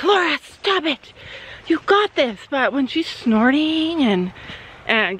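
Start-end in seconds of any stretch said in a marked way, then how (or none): 0.0-2.4 self pep talk. Retold or imitated. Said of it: "And then I'm like".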